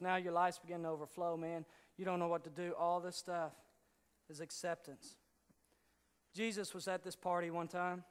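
ordinary delivery

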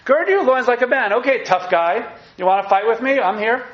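high and loud, God taunting Job; God's booming challenge. Acted. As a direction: boom it out like God calling Job to fight. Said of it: "To Job".

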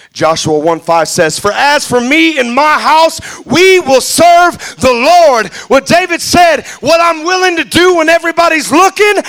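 loud, high shouted preaching; fiery preacher building to a shout; preaching at full force. Acted. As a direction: bring it home to the congregation, full voice.

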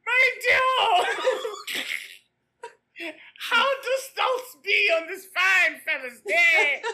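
shouting a silly olde-English greeting at Carrie; Monty Python character. Retold or imitated. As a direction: ham up a pompous olde-English gent. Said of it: "now you just sound like a Monty Python character".

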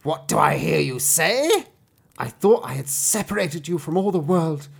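high, loud villainess voice; angry enchantress. A narrator's reading. Enchantress accusing the girl she shut away, high angry cry. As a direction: scold as the outraged enchantress. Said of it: "cried the enchantress".

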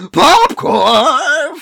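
very high, loud anime shriek. Pretend. Mock anime shout.

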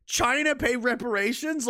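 high, slow disbelief; can't believe what he reads; high stunned scoff; incredulous. Real, not acted. Incredulous rant.

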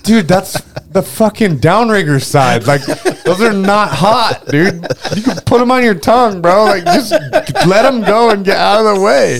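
high, loud retold telling-off; exasperated yelling. Telling a friend the wires aren't hot, exasperated. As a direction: yell it over, fed up, at a clueless buddy.

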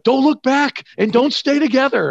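high, loud mock-panicked orders; retold urgent shout. Friends fleeing the doorman shouting to each other, urgent. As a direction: call out to buddies on the run.

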